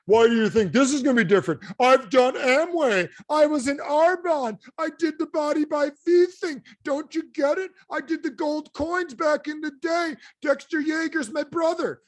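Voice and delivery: high-pitched, indignant acted rant; hammed-up defensive tirade of a fed-up skeptic; pissed off. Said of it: "Pissed off, like".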